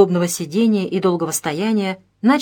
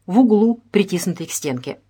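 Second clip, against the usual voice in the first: usual speaking voice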